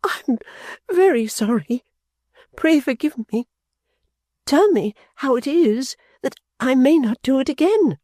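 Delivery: put-on high, pleading young woman; Little Dorrit pleading with her father; trembling apology. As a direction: beg forgiveness anxiously. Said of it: "pale and trembling".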